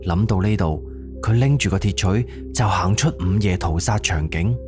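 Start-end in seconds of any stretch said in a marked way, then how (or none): none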